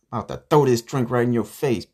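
heated confrontation; angry threat. Acted. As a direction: warn the server with heated outrage. Threatens the server.

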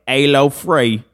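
exaggerated British accent greeting; mock British accent. Riffing on British memes, mock British accent. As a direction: put on a silly British accent. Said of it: "I also like the British memes".

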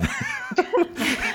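sudden high-pitched laugh. Real, not acted. Laughs after being teased. (risada aguda)

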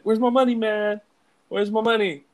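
high, loud cartoon threat; hammed-up high-pitched shakedown; mock angry demand. Acting Stewie beating Brian for money.

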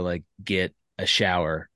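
usual speaking voice

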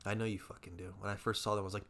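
usual speaking voice

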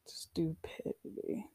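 slow, drawn-out whisper; whispered repeat. Retold or imitated. Patient repeating her retort about working on anger, whispered. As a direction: whisper it slowly, letting each syllable sit.

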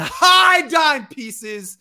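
slow, loud sportscaster hype; hype shout. Pretend. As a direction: hype it up like a play-by-play call. Hyping a sports hero.

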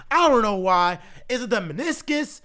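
ordinary talk

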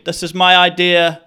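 loud, high announcement; proud proclamation. Pretend. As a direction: shout it to everyone. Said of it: "walk around with it above your head".

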